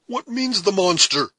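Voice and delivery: high, loud, shocked question; astonished demand. A narrator's reading. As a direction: blurt it out in disbelief. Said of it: "demanded the astonished Heyward".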